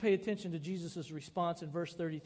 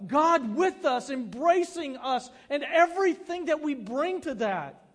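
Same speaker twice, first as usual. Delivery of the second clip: preaching at full force; 6 semitones higher, 9 dB louder than usual. Acted. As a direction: preach it out, each line swelling.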